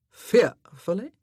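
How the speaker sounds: slow, loud, incredulous drawl on Fearfully; pained drawl. Acted. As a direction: drag the word out as if it offends him.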